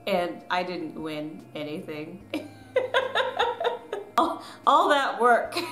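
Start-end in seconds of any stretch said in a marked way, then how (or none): 2.3-5.7 rueful laugh through the words, slower; amused regret, laughing. Real, not acted. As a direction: laugh at all that wasted effort. Laughing at losing.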